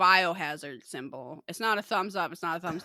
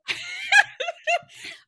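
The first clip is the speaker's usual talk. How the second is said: very high, loud giggling fit; helpless high laugh; 5 dB louder than usual. Real, not acted. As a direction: dissolve into real laughter. Helpless laughter over clown fashion.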